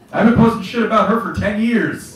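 normal talking voice

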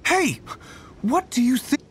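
high sharp call to stop them. Acted. Hunter calling out to stop them.

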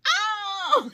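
high-pitched squeal breaking into laughter; delighted "oh" tipping into a high laugh; excited squeal. Retold or imitated. Reacting to designer sunglasses, excited squeal. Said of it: "I am just like".

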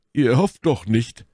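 high, loud, slow disbelief; incredulous. A narrator's reading. Porthos incredulous at Athos's boldness.

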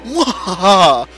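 slow booming evil laugh; evil villain laugh. Acted. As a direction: cackle in triumph. Villain triumphs with talisman.

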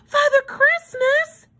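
gasp, then exclaim it high in amazement; astonished exclamation. A narrator's reading. Susie astonished at meeting him, high awed exclamation. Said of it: "she finally exclaimed in a hushed voice".

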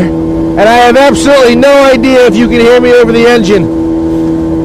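high, loud yelling over a boat motor; shouting over engine. Real, not acted. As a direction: belt it out over roaring engine noise. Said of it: "Now, I'm gonna scream here".

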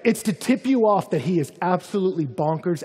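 ordinary talk, nothing marked

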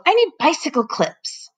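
high put-on professor voice; lightly voiced eccentric professor; a mild called-out demand. A narrator's reading. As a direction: bit of a call to the housekeeper. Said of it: "he yelled".

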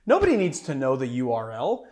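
ordinary talk, nothing marked

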